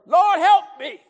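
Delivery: high, loud shouted plea; acted cry for help from the street; crying out to God. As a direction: belt out a desperate prayer. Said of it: "willing to cry out to God".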